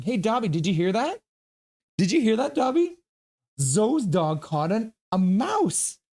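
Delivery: excited dog talk. Real, not acted. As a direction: lift the voice way up to thrill the dog.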